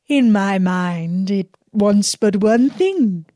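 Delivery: a lightly voiced sly villain posing as a holy woman; disguised magician voice. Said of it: "said the false Fatima".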